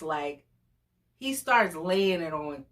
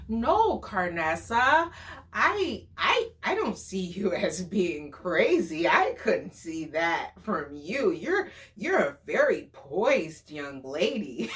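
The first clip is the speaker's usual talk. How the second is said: imitating articulate date. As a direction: imitate his careful, measured way of talking. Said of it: "He was a very articulate".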